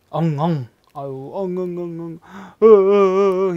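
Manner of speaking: loud hammed-up wail, not real crying; mock wailing. Shown as a demonstration. Teacher acting out loud crying for students. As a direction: wail it like a bawling child, for show. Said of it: "이렇게 우는 거".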